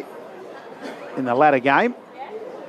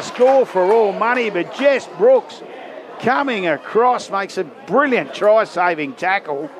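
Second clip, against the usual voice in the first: higher-pitched, excited live match call, easing towards the end; excited play-by-play; 6 semitones higher than usual. Real, not acted. Commentator calls a last-ditch try-saving tackle to listeners, excited.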